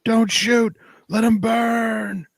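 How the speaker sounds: high, slow desperate shout; movie quote shout. Retold or imitated. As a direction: yell it like a war film soldier. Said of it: "makes me think of that scene in Saving Private Ryan".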